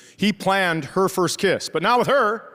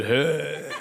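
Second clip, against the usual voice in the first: put-on boyfriend's hey; 3 semitones lower, 4 dB quieter than usual. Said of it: "Yeah, he said".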